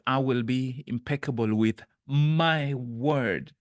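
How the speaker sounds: slow and loud, "my" stressed before a falling end. Shown as a demonstration. Reading Carol's pledge, slow, stressing my. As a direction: press "my" in a slow, firm read. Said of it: "which word am I emphasizing".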